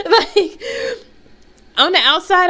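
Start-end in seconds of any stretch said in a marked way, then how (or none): none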